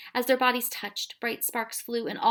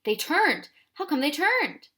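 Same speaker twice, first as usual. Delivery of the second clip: louder, rising to a higher relieved outburst; relieved cry; 7 semitones higher, 5 dB louder, slower than usual. A narrator's reading. Ben seeing the army turn, a relieved cry. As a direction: blurt out the relief. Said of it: "Ben cried out in relief".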